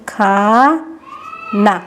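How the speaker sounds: very slow, stretched word; slow teaching demo; slow sounding out. Shown as a demonstration. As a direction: draw it out to show the spelling. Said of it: "तो कैसे लिखेंगे?".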